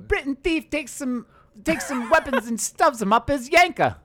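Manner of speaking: put-on British accent. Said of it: "I wanna hear your British accent".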